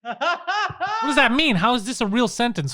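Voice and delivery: high, slow, laughing what does that mean; cracking up in disbelief. Real, not acted. Amused disbelief at show.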